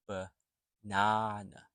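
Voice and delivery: "banana" slowly, buh, pause, NAH-nuh; slow syllable breakdown. Shown as a demonstration. Teacher breaks the word down, "banana" slow and in pieces. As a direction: split "banana" after the first schwa and stretch it out. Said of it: "So when you say it quickly".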